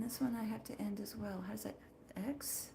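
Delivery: soft unvoiced whisper. Real, not acted. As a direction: whisper as you wrap up. Ending the stream quietly.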